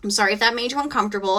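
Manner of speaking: usual speaking voice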